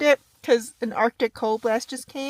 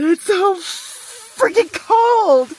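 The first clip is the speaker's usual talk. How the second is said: high, loud, slow wail about the cold; shivering cry; cold complaint; 8 semitones higher, 10 dB louder, much slower than usual. Real, not acted. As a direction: stretch it out, freezing. Shivering at freezing canyon.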